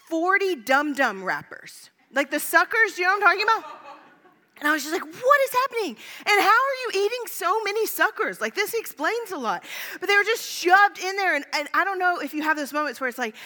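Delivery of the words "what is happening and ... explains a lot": high, disbelieving outburst; incredulous. Retold or imitated. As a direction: pitch it way up in shocked disbelief. Finds dozens of candy wrappers.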